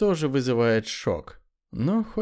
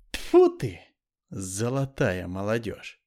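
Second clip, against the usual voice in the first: disgusted; 6 semitones lower than usual. Real, not acted. Spitting in disgust.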